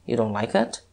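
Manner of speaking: usual speaking voice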